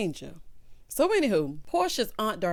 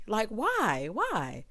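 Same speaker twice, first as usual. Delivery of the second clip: ordinary talk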